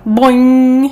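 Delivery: loud, ringing spring noise; bouncing boing. A narrator's reading. Lamb springs out of the shed, loud boing. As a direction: punch it out like a spring releasing. Narrator imitates spring jumps.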